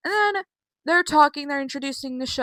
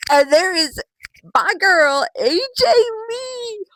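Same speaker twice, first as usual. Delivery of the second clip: high, loud hype announcement stretching the name; excited fan cheer; 10 semitones higher, 8 dB louder, slower than usual. Real, not acted. Fan hailing A J Lee's return, excited cheer. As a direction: whoop it up for your favourite wrestler.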